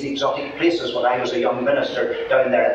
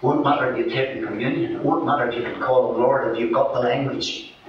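usual speaking voice

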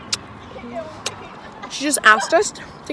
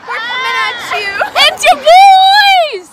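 excited; 11 semitones higher, 7 dB louder, slower than usual. Real, not acted. Vlog intro greeting to viewers.

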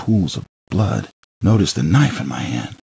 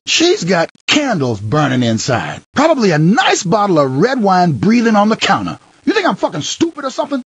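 jealous rage; 13 semitones higher than usual. Acted. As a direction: spit out the jealous accusation. Jealous rant at visitor.

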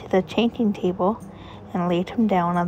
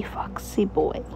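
whispered giggle; 5 dB quieter, slower than usual. Acted. Mother giggling at baby.

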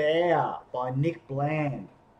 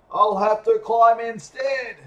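higher, louder call; yelled; 7 semitones higher, 7 dB louder than usual. Acted. Bear shouting up to Elephant in the treetop. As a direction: shout it up the tree. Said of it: "yelled Bear".

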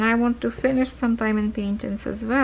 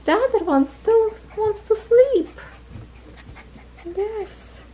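much higher gentle don't bark; high pet-talk scold; 11 semitones higher than usual. Real, not acted. Owner gently telling a puppy not to bark, high pet talk.

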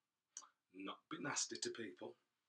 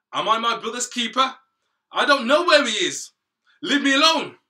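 much higher, much louder angry yelling; furious shout; 13 semitones higher, 23 dB louder than usual. Acted. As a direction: bellow it back in defiance. Cain defies God angrily.

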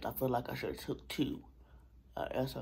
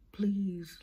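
strained outro; 6 semitones higher, 6 dB louder than usual. Real, not acted. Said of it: "my mouth hurts so bad I can't even say my outro".

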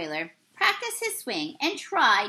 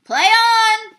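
high, full-volume field call; umpire's yell; 10 semitones higher, 12 dB louder than usual. A narrator's reading. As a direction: bellow the restart like an umpire. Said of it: "the umpire finally yelled".

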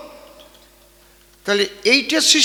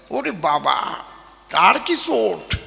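mock awe; 3 semitones lower, slower than usual.

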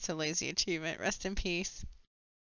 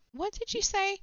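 high indignant squeak; offended shock; 13 semitones higher than usual. Acted. Developer hears himself insulted, indignant squeak. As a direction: shriek the question in outrage.

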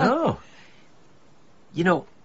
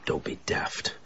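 soft whisper; 12 dB quieter than usual. Real, not acted. Grieving widower reassuring friend.

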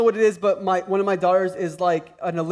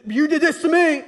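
mocking blame; 8 semitones higher, 5 dB louder than usual. Mocking a blaming parent.

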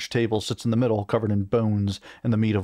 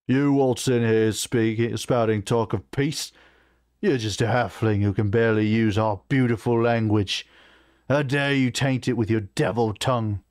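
scornful orc war chief. Acted. War chief scolding a halfling for speaking their language, scornful. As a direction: sneer it as a contemptuous orc.

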